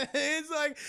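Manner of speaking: usual speaking voice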